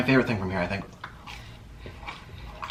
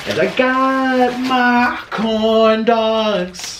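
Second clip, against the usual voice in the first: slow drawn-out; 7 semitones higher, much slower than usual. Pretend. Drawn out food announcement.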